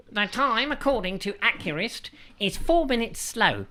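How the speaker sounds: high, prim announcer voice; speaking clock voice. Acted. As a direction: read it like an old speaking clock. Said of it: "old joke 'cause of the speaking clock".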